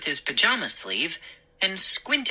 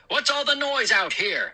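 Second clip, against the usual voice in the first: raised-pitch, irritated call; shouted question; 8 semitones higher, faster than usual. Acted. McCall asking neighbor about noise, mild shout. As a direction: call out over the yards. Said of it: "I heard Mister McCall shout".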